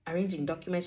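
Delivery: ordinary talk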